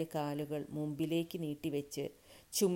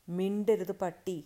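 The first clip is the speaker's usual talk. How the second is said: loud, slow, angry order; roared command; 3 semitones higher, 8 dB louder than usual. A narrator's reading. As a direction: growl out the order, a touch of roar. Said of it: "കിതച്ചുകൊണ്ട് ഗർജിച്ചു". (ഗർജിക്കുന്ന ആജ്ഞ)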